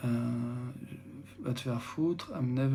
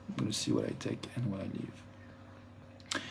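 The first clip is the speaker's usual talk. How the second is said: 1 soft slow murmur; 5 semitones lower, 9 dB quieter than usual. Real, not acted. Quiet translated aside.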